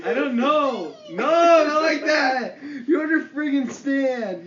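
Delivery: high, loud exasperated outburst; slow, stretched objection over the laughter; loud protest. Real, not acted. Correcting the friend's squeaky imitation, loud protest.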